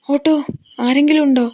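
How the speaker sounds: loud, high, urgent call; calling for help. A narrator's reading. Daughter searches the night street for an auto, calling for help. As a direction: shout out for anyone nearby.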